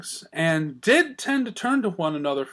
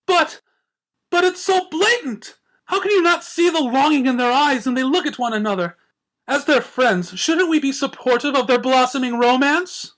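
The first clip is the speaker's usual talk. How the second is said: much higher, louder swooning lady; dramatic insistence; 10 semitones higher, 6 dB louder than usual. A narrator's reading. Rarity pleading with Twilight, high dramatic. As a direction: plead for romance, high and theatrical.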